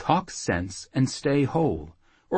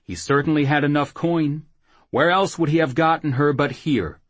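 raised, louder challenge; pressing accusation; 6 semitones higher, 5 dB louder than usual. Acted. Jackal confronting the whoremaster about the coin, pressing accusation. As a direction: press him hard for an answer.

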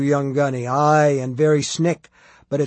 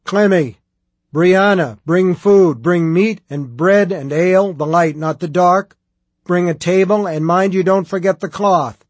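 bawled orders, loud and raised in pitch; full-volume shouting; 4 semitones higher, 7 dB louder than usual. A narrator's reading. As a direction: shout orders to the whole household like a megaphone. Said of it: "like listening to a woman shouting into an electric megaphone".

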